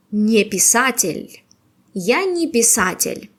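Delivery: ordinary talk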